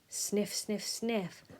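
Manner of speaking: usual speaking voice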